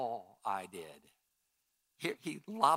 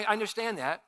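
ordinary talk

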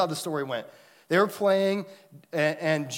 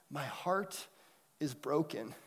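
soft, halting imitation of a heartbroken four-year-old; most pitiful voice; 10 dB quieter, slower than usual. Four-year-old weeping to himself in the corner, mock pitiful voice. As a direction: play it small and pitiful, pausing before the end. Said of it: "in the most pitiful voice you could ever hear".

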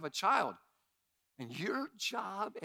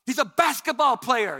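loud, high, forceful insistence; insistent coach dad; 6 semitones higher, 11 dB louder than usual. Retold or imitated. Dad insisting to wife his son is a player, loud and forceful. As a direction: shout down the objection. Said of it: "I'm like".

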